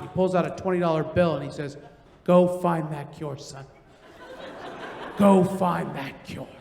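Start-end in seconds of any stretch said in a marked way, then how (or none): 2.2-3.6 slow, low-pitched and heartfelt; solemn encouragement. Acted. Customer handing a tip to the young driver, solemnly moved. 5.2-6.5 slow, low-pitched and heartfelt; solemn encouragement. Acted. Customer handing a tip to the young driver, solemnly moved.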